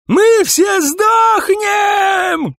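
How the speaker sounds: very high, loud, stretched-out scream. A narrator's reading. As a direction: shriek doom at the whole cell block, dragging the words. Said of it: "кричал Сайрус". (истошный протяжный крик)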